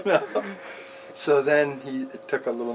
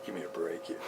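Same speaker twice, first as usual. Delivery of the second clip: exasperated inner mutter; 7 semitones lower, 13 dB quieter than usual. Retold or imitated. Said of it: "my mind was going".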